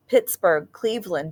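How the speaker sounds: ordinary delivery, nothing marked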